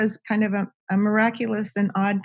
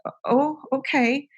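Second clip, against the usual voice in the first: higher, hesitant retold "oh, okay"; taken-aback reply; 6 semitones higher than usual. Teller replying to Robin's strange news, taken aback. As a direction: imitate being mildly thrown, pitch lifted.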